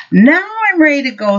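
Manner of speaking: put-on high, fast, excited child voice; a small boy bursting to leave; eager little boy.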